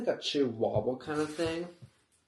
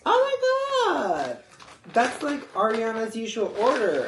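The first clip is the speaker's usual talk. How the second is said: squealing barista voice, much higher; gushing barista; 9 semitones higher, 4 dB louder than usual. Retold or imitated. Barista gushing about [name].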